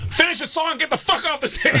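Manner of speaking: high mock annoyed host; annoyed karaoke host. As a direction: shoo him off the stage. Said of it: "the guy running the karaoke goes".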